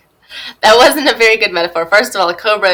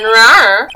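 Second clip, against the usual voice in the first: loud, playful "rawr"; mock leopard roar; 8 dB louder than usual. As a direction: growl it out like a pretend leopard. Mimics a leopard woman's growl.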